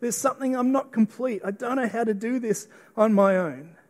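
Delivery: a man crying out alone; weeping despair. Retold or imitated. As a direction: lament as if face down in the garden. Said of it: "Adam face down, weeping in the garden, going".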